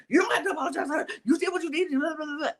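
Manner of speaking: high, fast mocking voice of a bossy woman; mimicking a snippy reality star, high and fast; mocking snap. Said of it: "and was like".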